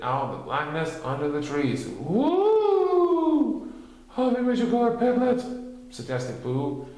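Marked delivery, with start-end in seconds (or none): 1.9-3.7 loud high woo; high ghostly whoop. A narrator's reading. Spooky moan in the dark, high and loud. 4.2-5.4 high, louder, fast bear voice; urgent Pooh. A narrator's reading. Pooh urging friends to find Piglet, high and fast.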